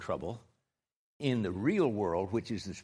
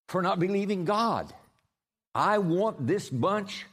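full-force preaching; 4 semitones higher than usual. Acted. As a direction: thunder it at the congregation.

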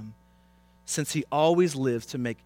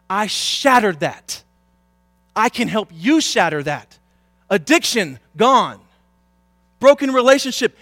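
loud, punched declarations with pauses; triumphant preaching; 8 semitones higher, 11 dB louder than usual. Real, not acted. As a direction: declare each victory loud and high. Preaches at full force.

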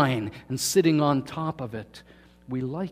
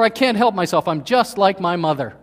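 higher, louder whiny excuse; mock excuse; 4 semitones higher, 6 dB louder than usual. Excuse-maker blaming mother, loud and higher. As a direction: plead helplessness, hammed up.

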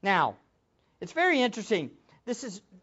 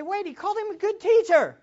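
skeptical listener; 8 semitones higher, faster than usual. Retold or imitated. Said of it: "I know, you say".

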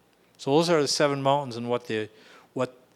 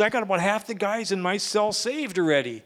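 excited; 7 semitones higher than usual. Retold or imitated. Friend gushing about jail.

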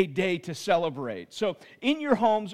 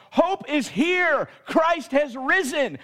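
shouted, high and slow; yelling out; 6 semitones higher, 4 dB louder, slower than usual. Shown as a demonstration. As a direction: call it out the front door. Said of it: "you're yelling out".

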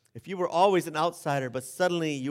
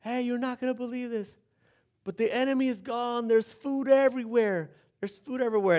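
calling out; 8 semitones higher than usual. Retold or imitated. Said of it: "they yell out at the gate".

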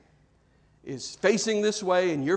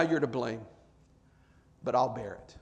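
low, soft, forgiving concession; gentle acceptance; 6 semitones lower, 6 dB quieter than usual. Acted. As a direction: concede it quietly and take the burden. What Christ did say, accepting burden.

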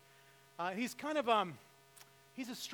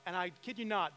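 usual speaking voice

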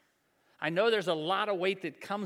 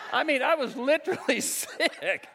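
laughing disbelief; 7 semitones higher than usual. Real, not acted. Laughing at the Tommy Chong story.

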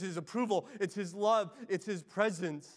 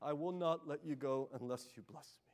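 softer, pleading prayer; quiet plea; 4 semitones lower, 7 dB quieter than usual. Acted. A worshipper echoing Jacob's cry, quietly pleading. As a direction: beg God quietly and humbly. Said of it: "echo Jacob's cry".

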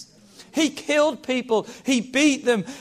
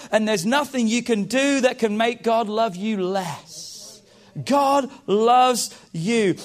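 loud, rising sermon climax; preaching at full force; 2 semitones higher, 4 dB louder than usual. Real, not acted. Pastor proclaiming God's love to the church, full force. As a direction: drive it home to the congregation.

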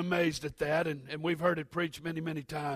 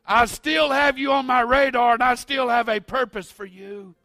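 loud, high, fervent preaching climax; full-force preaching; 8 semitones higher, 10 dB louder than usual. Acted. Pastor assures the congregation of God's purpose, fervent loud preaching. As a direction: preach it with everything.

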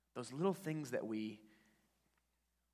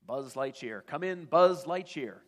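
louder radio call, rising on come in; calling over the airwaves; 3 semitones higher, 12 dB louder than usual. Retold or imitated. A TV voice calling Buzz, broadcast call. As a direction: hail him like a transmission. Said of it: "Buzz hears this voice".

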